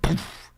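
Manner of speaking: soft puff of air for a landed punch; airy vocal sound effect; imitated hit noise. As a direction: puff out a quiet "poof" impact.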